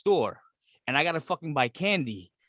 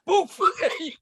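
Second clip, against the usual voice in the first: hammed-up excitement; 7 semitones higher than usual. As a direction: gleefully hand out canned goods. Acting out giving canned goods.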